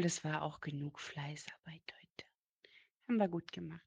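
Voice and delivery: quiet whisper under the breath; whispered aside. Real, not acted. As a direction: whisper it to yourself. Muttered aside to himself. (geflüstert)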